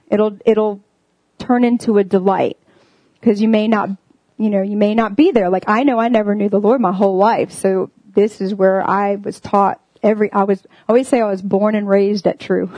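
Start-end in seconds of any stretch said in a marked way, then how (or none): none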